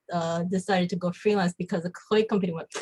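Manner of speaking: usual speaking voice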